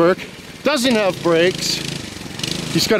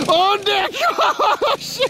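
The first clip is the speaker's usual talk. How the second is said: high, giggling disbelief; laughing exclamation; 11 semitones higher, much slower than usual. Real, not acted. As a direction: crack up at the mishap, pitched high. Laughing at a stunt gone wrong.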